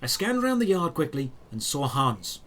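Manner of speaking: ordinary delivery, nothing marked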